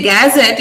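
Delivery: "gazette" with a strong, full-vowel GAZ at the start; front-load "gazette" as the mistake; stressing the G. Shown as a demonstration. Teacher showing the student's error, "gazette" stressed on GAZ. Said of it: "you're stressing g".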